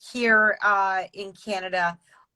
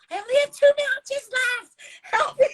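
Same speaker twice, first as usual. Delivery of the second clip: hammed-up helpless plea, much higher; mock desperate plea. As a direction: pretend to beg for rescue. Pleading retold to canoe instructors.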